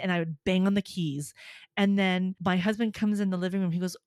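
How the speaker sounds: ordinary delivery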